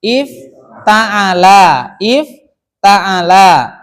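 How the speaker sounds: "Ifta'ala" loud, with long stretched syllables; very drawn-out, forceful "Ifta'ala"; slow, loud model. Shown as a demonstration. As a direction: drill "Ifta'ala" loudly, breaking after the first syllable. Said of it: "Ada fi'il madhi apa".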